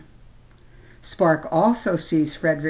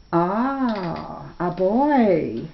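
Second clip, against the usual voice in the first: musing bear; much slower than usual. Acted. Bear muses over the boy.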